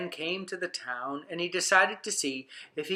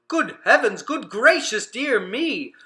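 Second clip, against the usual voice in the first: crying out in alarm; 5 semitones higher, 5 dB louder than usual. Acted. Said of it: "they began to cry out".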